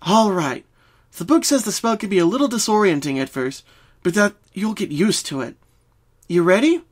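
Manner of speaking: Twilight asking. A narrator's reading. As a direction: check she's ready, upbeat. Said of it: "Twilight asked".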